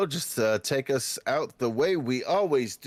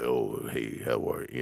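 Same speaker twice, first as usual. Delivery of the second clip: much lower, deep greeting; low character voice; 11 semitones lower, 3 dB quieter than usual. Shown as a demonstration. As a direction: drop the pitch for a new character. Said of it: "try to change the pitch".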